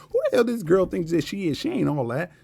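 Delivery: put-on scornful disbelief; mock catty hater. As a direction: imitate someone jealously putting a girl down. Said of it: "This is how they look at you".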